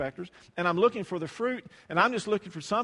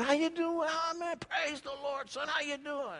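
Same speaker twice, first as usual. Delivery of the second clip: lightly voiced hearty old classmate, high and soft; mock classmate; 9 semitones higher, 6 dB quieter than usual. Preacher imitating a classmate at the reunion, high hearty greeting. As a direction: play the backslapping classmate, pitched up.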